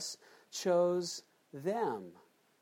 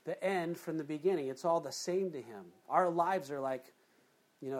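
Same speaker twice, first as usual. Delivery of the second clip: ordinary delivery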